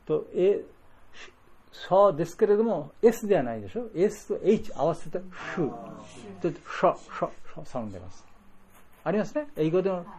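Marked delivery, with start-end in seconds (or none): 5.6-8.2 unvoiced シュー and シャ hissed; voiceless sh sound. Shown as a demonstration. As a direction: hiss シュー without voice.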